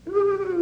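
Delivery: high mock swooning ooh; a hammed-up sighing ooh from the crowd. Preacher mocking the weeping crowd, high swooning ooh.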